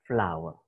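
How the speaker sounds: "flower" said clearly as /flaʊə/ with a falling end; teacher demo of "flower"; model pronunciation. Shown as a demonstration. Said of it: "you read it".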